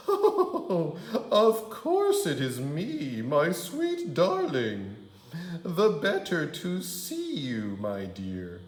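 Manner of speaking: high, laughing fake granny voice; wolf as granny. Wolf as Grandma laughing off Red's question. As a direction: chuckle through the granny act.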